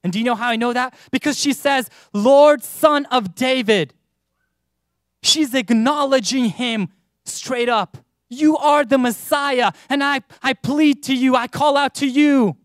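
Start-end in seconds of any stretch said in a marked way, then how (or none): 2.1-3.9 a loud cry of "Lord", then slow; calling out. Acted. A desperate woman calls out loudly to Jesus. 8.3-12.6 pleading. Acted. The woman loudly begs Jesus for help.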